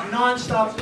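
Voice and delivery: ordinary talk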